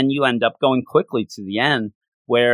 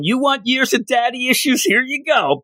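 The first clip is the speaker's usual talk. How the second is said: high-pitched playful yell, raised much higher; hammed-up villain yelling; mock yell; 11 semitones higher than usual. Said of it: "Dormammu yelling".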